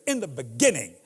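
scripture read, deep and measured; booming biblical narration. Acted. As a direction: intone it like Genesis. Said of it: "and I quote scripture".

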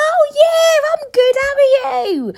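very high, loud, gushing answer; delighted reply. Acted. Replying to someone who introduced themselves, over-the-top delighted. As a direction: burst out in an exaggerated high, friendly voice. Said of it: "Then I'll be like".

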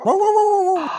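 high, loud imitated dog howl; dog howling at bears. The dog sees bears coming, loud imitated howl. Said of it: "And she would start".

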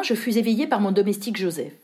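ordinary delivery, nothing marked

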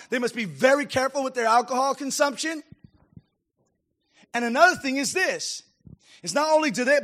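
loud, high preaching push; preaching at full force. Real, not acted. Pastor preaching hard to the congregation, loud and fervent. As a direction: drive it home from the pulpit.

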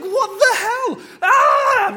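slow, high, loud screaming in terror; terrified scream. Retold or imitated. The terrified women fleeing the tomb, screaming. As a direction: scream like you're running from the tomb. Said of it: "They're like".

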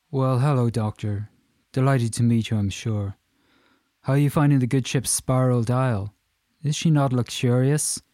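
put-on booming, chatty Irish accent. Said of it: "in a strong Irish accent".

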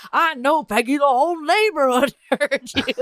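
Hank, pissed off. Retold or imitated. Hank scolding Peggy over the neighbors hearing, mock pissed off. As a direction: play irritated, breaking into a laugh. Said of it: "He's all pissed off".

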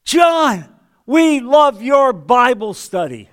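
high, bright and loud, slow and beaming; a fan gushing at his Bible teacher; delighted greeting. Retold or imitated. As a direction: greet a local celebrity happily. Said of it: "this guy looks at me and says".

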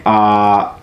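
ordinary talk

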